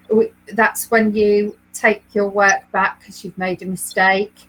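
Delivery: ordinary delivery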